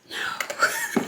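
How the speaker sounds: a breathless whispered no; whispered refusal. Real, not acted. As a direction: drop to a whisper for the no. Emphatic refusal of a regular job.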